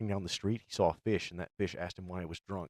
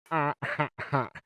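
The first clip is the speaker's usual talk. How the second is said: Squidward's slow "ha ha ha"; higher, deliberate fake laugh; mocking laugh; 6 semitones higher, 4 dB louder than usual. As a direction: laugh mockingly, one beat at a time. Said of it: "Squidward started mocking him".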